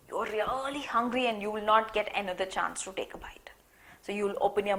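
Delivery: put-on hushed whisper to draw children in. Teacher whispering to children about one hungry bite.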